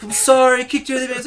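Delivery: high, mock tearful plea; mock crying apology. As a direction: lightly ham up a tearful apology. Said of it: "he's like".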